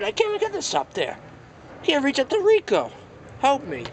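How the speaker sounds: a small figure struggling to reach; high strained toy voice. Acted. Toy can't reach Rico and asks for help, high.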